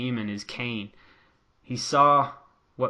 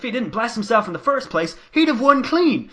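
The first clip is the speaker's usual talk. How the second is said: higher, louder mock commentator rant; imitating Corey Graves; 11 semitones higher, 7 dB louder than usual. Corey Graves scoffing at AJ, mock indignant and raised. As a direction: play Graves as a scornful heckler. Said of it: "he's like".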